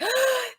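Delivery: sharp high excited gasp; mock gasp. Joey at the auction, sharp excited gasp. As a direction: gasp like you just realized you won. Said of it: "Joey perks up and he's like".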